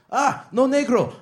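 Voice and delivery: high, loud, slow put-on voice of the man at the door; mock Mexican man. The man at the door turns him away, high put-on voice. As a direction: drag out a loud refusal in a put-on voice.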